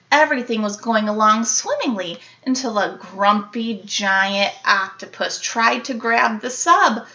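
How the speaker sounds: plain reading voice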